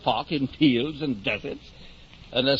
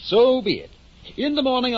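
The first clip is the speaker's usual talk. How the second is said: grand declaration; 11 semitones higher, 4 dB louder than usual. Acted. Innkeeper grandly agrees.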